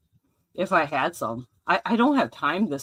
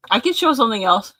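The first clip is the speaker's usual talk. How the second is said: excited; 6 semitones higher, 7 dB louder than usual. Real, not acted. Covering while friend eats cracker.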